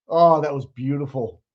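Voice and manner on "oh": ordinary talk, nothing marked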